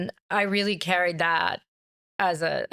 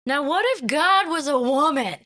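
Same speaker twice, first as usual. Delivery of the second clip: high, louder Rhode Island mom voice; mom's Rhode Island accent; 8 semitones higher, 4 dB louder than usual. Retold or imitated. Mom in the car imitated with a Rhode Island accent. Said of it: "she had this really intense Rhode Island accent".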